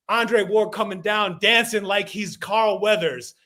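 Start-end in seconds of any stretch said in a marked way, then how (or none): none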